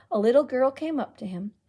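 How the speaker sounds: ordinary delivery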